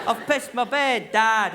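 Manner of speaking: slow, loud mock child's announcement; child calling Dad. Shown as a demonstration. Same confession called to Dad.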